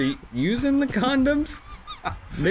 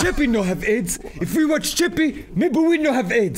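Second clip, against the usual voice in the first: mock African accent, rising in pitch, half laughing; hammed-up African accent in broken English; caricatured African accent; 5 semitones higher than usual. Joker plays Africans distracted by a film, caricatured African accent. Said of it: "Why do they sound like the pirate from Captain Phillips?".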